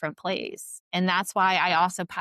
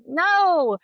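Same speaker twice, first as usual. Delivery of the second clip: emphatic no; 10 semitones higher, 7 dB louder than usual. Retold or imitated. Firmly rejects patient calling herself lazy.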